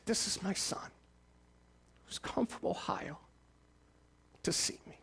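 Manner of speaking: dying father, voice giving way, tearfully whispering to his son. Retold or imitated. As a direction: let it fade to a breathy whisper as tears come. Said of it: "with tears welling up in his eyes".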